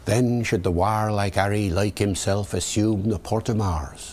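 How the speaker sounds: Shakespearean English accent, rhotic R; original pronunciation. Shown as a demonstration. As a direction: Elizabethan original pronunciation reading. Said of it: "David the O P".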